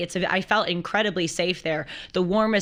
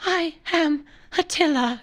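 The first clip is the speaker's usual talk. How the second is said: Attila impression; 8 semitones higher than usual. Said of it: "And he speaks like this".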